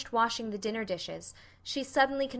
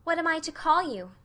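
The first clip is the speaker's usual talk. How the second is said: put-on high girlish questioning; earnest little girl, pitched up; curious Anne; 7 semitones higher, 4 dB louder than usual. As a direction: ask eagerly as a child.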